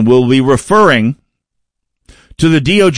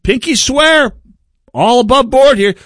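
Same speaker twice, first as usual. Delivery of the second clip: shifty fox; 7 semitones higher than usual. Retold or imitated. Fox watchdog denying the missing chickens, high and wide-eyed innocent. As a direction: protest innocence like a guilty fox. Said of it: "your fox watchdog says".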